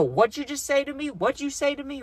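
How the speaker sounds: high, indignant retold demand; mad demand. A woman angrily confronts the narrator. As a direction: mimic her angry challenge. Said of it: "And she got mad".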